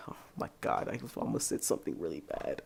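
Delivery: whispered sigh; hushed whisper of alarm. Real, not acted. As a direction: gasp it under your breath. Catching himself.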